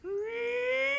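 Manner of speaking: high, thrilled little cry; excited princess squeal. Acted.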